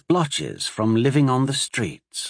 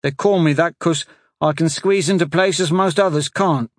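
put-on high, louder child voice; a small abused boy telling his story; frightened street boy; 6 semitones higher, 5 dB louder than usual. A narrator's reading. Street boy telling the sorcerer about his master, high child voice.

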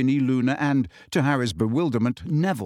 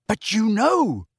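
shout; 8 semitones higher, slower than usual. Acted. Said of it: "shouted Harry".

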